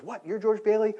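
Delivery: high, loud challenge of an angry husband; confrontational husband. Retold or imitated. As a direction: square up to the man, pitched high and loud. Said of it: "and he goes".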